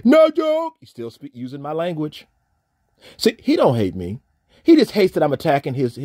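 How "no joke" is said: high, louder mock voice; lightly mocked quote from the email. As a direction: toss it off in a sneering squeak. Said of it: "Oh, Poe baby".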